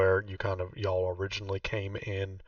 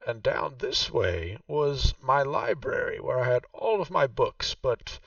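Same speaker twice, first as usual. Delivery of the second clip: game master voicing Vaku, noticeably higher; Vaku's voice; 5 semitones higher, 5 dB louder than usual. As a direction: play the proud host showing her old home. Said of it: "And she says".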